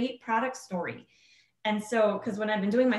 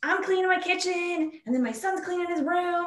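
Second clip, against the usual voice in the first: quoting a thrilled patient, pitch climbing higher and faster; excited patient; 6 semitones higher, 4 dB louder than usual. Retold or imitated. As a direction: gush it like a customer who can't believe the results. Patient's excited report, quoted.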